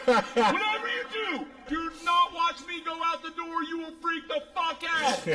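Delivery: yelling. Retold or imitated. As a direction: yell instructions over roaring engines. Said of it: "she starts yelling at me".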